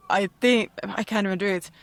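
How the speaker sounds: ordinary talk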